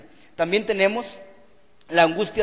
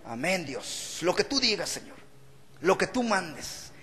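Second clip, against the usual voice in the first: mock meek piety; 2 semitones lower, 7 dB quieter than usual. Mock meek pious Moses.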